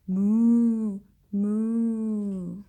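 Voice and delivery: a mooing cow, loud on the first moo. Acted. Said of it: "The cow says".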